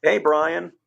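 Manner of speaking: normal talking voice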